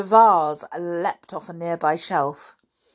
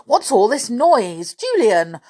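raised, loud questioning; irritated demand; 5 semitones higher, 5 dB louder than usual. A narrator's reading. Uncle roused by noise questions Julian, irritated. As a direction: demand to know about the racket.

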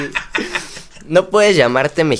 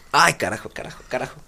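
cursing under breath; 6 dB quieter, faster than usual. Real, not acted. Struggling to hold the game.

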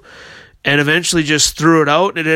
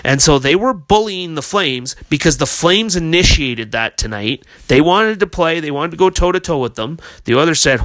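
ordinary talk

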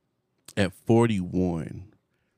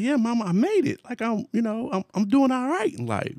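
pitched way higher, beaming; proud excited. Retold or imitated. Son boasting to his mama, much higher and excited. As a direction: sell it like a proud kid. Proudly telling mom he made it.

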